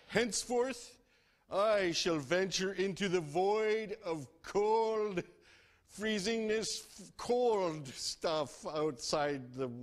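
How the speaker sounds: high, grand, slow mock hero voice; lofty storybook coyote; pompous coyote. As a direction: announce it like a brave explorer.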